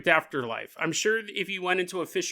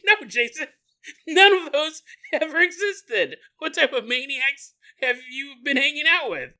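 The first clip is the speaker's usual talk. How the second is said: higher, incredulous mock disbelief; exasperated friend; 7 semitones higher than usual. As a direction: scold Jason like he's lost it. Retorting to friend Jason.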